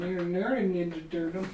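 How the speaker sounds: put-on high, loud little-kid voice with a lisp; little brother lisp. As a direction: play a small boy lisping about turtles. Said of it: "exactly what he sounded like".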